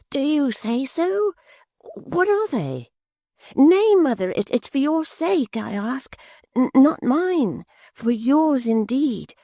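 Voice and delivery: put-on high, eager simple young man. Barnaby pleading with mother.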